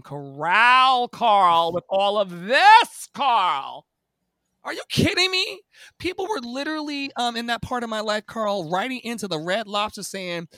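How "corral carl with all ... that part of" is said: loud and high, exasperated; indignant outburst. Real, not acted. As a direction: let it burst out, incredulous. Indignant rant about singing in restaurants.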